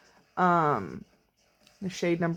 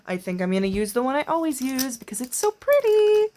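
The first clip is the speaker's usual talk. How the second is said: high, slow, gushing singsong; excited; 6 semitones higher than usual. Real, not acted. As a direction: sing it out, thrilled about glitter. Excited glitter time singsong.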